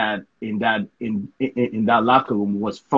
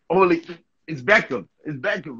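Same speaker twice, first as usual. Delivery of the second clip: higher, excited retold outburst; starstruck mock yell; 5 semitones higher than usual. Player sees Beckham walk in, starstruck mock yelling. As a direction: act out a fan losing it, without full volume. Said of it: "I start yelling in the locker room".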